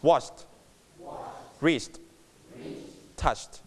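short ch, light t on "washed", "reached", "touched"; quick clean endings with a small t; softened final t. Shown as a demonstration. Teacher drills the class, soft t on "washed", "reached", "touched". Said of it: "ถือเบาๆนะครับ".

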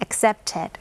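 "accepted" with -ed sounded as its own syllable; extra -ed syllable. Shown as a demonstration. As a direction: say "accepted" as ac-cep-ted. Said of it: "เพิ่มอีกหนึ่งพยางค์".

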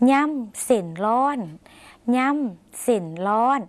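slow, drawn-out ย่ำเส้นร้อน, word by word; word demonstration. Shown as a demonstration. Teacher gives viewers the next word, slow careful demonstration. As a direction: say ย่ำเส้นร้อน very slowly, separating each syllable. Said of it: "คำต่อไปค่ะ".

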